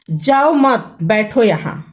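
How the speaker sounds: "जाओ मत" said as one rising group, then "बैठो यहां"; pause after मत. Shown as a demonstration. Teacher showing the speaker meant stay, pause after मत. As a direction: join मत to जाओ, break before बैठो. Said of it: "यहाँ पर उच्चारण के कारण वक्ता कुछ और बोला".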